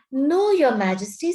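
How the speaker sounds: put-on eager boy. A narrator's reading. Edmund answers the queen.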